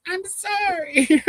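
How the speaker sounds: high, slow drawn-out sorry; creepy slow apology from a killer; lightly voiced horror villain; mock Psycho killer. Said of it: "how Psycho went".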